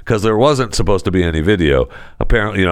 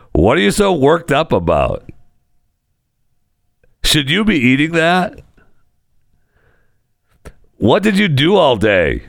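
mock nagging questions; 7 semitones higher than usual. Acting out dangerous questions.

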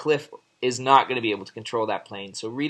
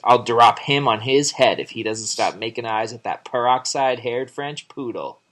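a touch of jealous anger, louder; jealous grumble; 7 dB louder than usual. Retold or imitated. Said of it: "poor old Rita is not too pleased".

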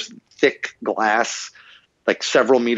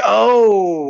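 long, high, drawn-out "oh"; mock realization; 11 semitones higher, 5 dB louder than usual. Actor pretending the coach's tip explains everything, mock realization. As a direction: exaggerate a sudden dawning understanding.

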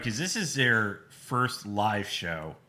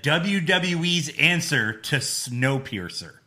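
slow commentator quote; slower than usual. Retold or imitated. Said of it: "Tom Phillips said".

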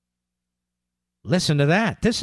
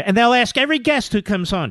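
normal talking voice

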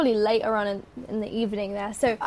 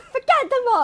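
very high, giddy exclamation; jubilant dismissal; 12 semitones higher than usual. Retold or imitated. Mum abandoning her mopping at the news, jubilant. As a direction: toss it off with high-pitched glee. Acting mother abandoning the mop.

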